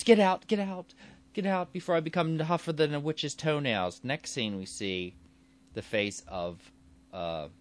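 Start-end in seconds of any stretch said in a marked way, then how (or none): 0.0-4.0 high, raised mock outburst; angry dismissal. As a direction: act out throwing someone out, pitched high. Producer throws reporter out.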